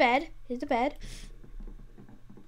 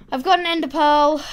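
loud, proud announcement; much louder, excited delivery; triumphant announcement; 3 semitones higher, 10 dB louder than usual. Real, not acted. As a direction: burst out with the good news. Triumphant return with the item.

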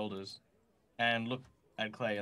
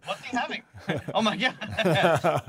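higher, louder words through real laughter; laughing; 10 semitones higher, 9 dB louder than usual. Real, not acted. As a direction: crack up mid-sentence. Player cracks up at the joke.

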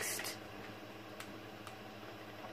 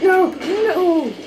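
high, loud cries of dismay; protesting yell; 7 semitones higher, 6 dB louder than usual. Real, not acted. Gamer losing the fight, protesting yell. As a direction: cry out in protest.

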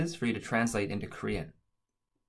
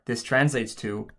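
ordinary talk, nothing marked

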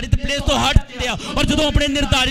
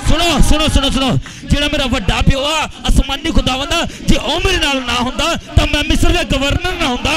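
raised, shouted sermon delivery; loud, driving preacher's proclamation; full-force preaching; 3 semitones higher, 5 dB louder than usual. Real, not acted. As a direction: preach it out to the whole congregation.

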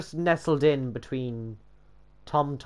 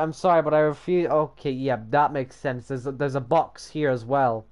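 usual speaking voice

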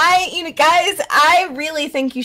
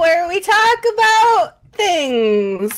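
high, sing-song show intro; mock show host; 5 semitones higher than usual. Host opens an improvised show to fill time, mock show-host voice. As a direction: stretch the ending like a host.

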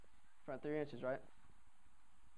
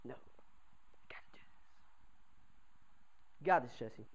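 whispered pep talk; 6 semitones lower, 11 dB quieter than usual. Retold or imitated. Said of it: "I was like".

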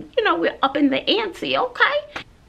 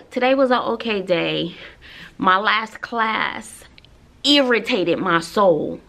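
normal talking voice

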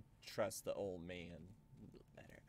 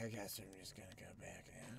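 whispering; 4 semitones lower, 10 dB quieter than usual. Real, not acted. Stealth gameplay aside.

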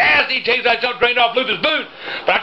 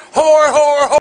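loud, raised repeated shouts; 3 semitones higher, 7 dB louder, slower than usual. Real, not acted. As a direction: yell each word out.

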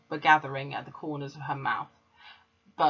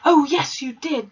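very high and very loud bellow; roaring; 10 semitones higher, 11 dB louder than usual. A narrator's reading. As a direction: blast it back at her. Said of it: "the Trunchbull roared back".

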